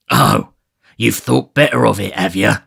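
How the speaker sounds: loud sneering growl. Acted. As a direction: sneer at her. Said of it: "growled Sikes".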